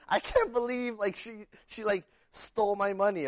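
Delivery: high put-on voice of a wronged cousin; upset cousin. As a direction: whine like he's still sore about it. Cousin still upset today.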